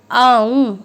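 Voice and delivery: आऊं with the final ऊं through the nose; आऊं, not plain आओ; nasalised aau. Shown as a demonstration. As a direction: end आऊं in a nasal hum. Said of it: "नून गुन्ना लगा दिया तो आऊं".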